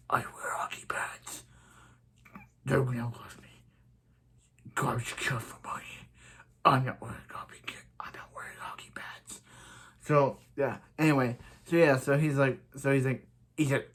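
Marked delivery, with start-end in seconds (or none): 0.1-9.4 whispered mock Batman voice. Mocking Batman characters in a hushed whisper. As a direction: hush it like a brooding vigilante.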